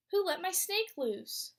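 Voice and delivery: ordinary delivery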